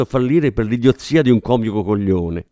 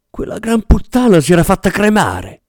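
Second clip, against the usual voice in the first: outraged outburst; 8 semitones higher, 4 dB louder than usual. Acted. Furious inner outburst at cremation.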